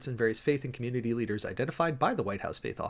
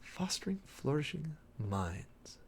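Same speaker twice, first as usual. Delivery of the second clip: soft slow murmur; 3 semitones higher, 8 dB quieter, slower than usual. Real, not acted. Re-reads phrase softly.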